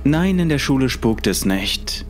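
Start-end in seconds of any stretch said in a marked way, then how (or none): none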